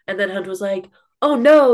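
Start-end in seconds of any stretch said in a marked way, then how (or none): 1.2-1.7 loud, high retold oh-no; hammed-up dismay; mock alarm. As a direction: imitate a worried objection, louder and higher. Retelling Hunt's alarmed objection.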